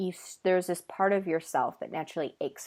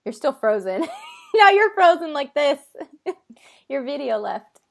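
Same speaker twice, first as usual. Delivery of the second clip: laughing loudly and high; 7 semitones higher, 7 dB louder than usual. Real, not acted. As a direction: burst out laughing at the frozen screen. Laughing at frozen video.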